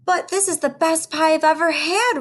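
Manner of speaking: lightly voiced happy giant; roaring giant. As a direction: roar it out like a delighted giant. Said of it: "roared one giant".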